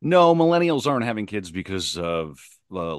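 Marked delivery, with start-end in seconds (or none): none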